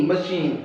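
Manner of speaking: मशीन with ch as sh, stress on शीन; model pronunciation. Shown as a demonstration. Teacher modelling the word for students, ch as sh. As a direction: say मशीन as ma-sheen. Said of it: "सी एच जो sound है वो भी एस एस की sound".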